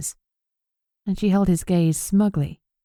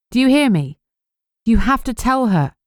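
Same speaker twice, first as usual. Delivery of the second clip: higher, louder insistent demand; urgent demand; 6 semitones higher, 4 dB louder than usual. A narrator's reading. Narrator demanding the killer confess, urgent and louder. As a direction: push her hard to answer. Demanding a response in her mind.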